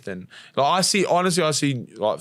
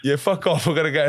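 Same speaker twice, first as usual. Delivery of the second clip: higher, louder mock brush-off; hurried, cursing dad impression; dismissive dad retold; 5 semitones higher, 4 dB louder than usual. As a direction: imitate a grumpy father ending the call. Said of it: "That's how he talks to me".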